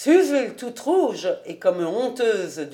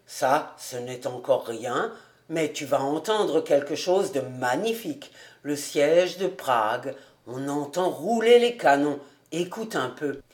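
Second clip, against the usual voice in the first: lower, proud bachelor voice; a hint of a lower self-pleased man; Fritz boasting; 7 semitones lower than usual. A narrator's reading. Fritz boasting to Suzel about his next piece, lowered voice.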